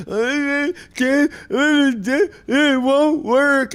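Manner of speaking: put-on high, slow wailing child's whine; imitating a crying child. Father mimics his daughter wailing over the trick, slow high sob. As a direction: drag it out like a sobbing little girl. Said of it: "crying in her bed".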